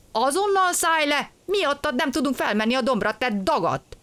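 high-pitched indignant order; angry scolding. A narrator's reading. Engine driver orders the stowaway off, angry scolding.

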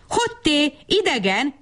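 high-pitched hail to a stranger; storybook shout at contained volume; calling out. A narrator's reading. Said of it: "kiáltotta".

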